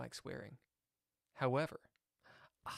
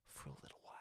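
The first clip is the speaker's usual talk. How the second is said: whisper; 20 dB quieter, much faster than usual. Real, not acted. Quiet aside about cursing stream.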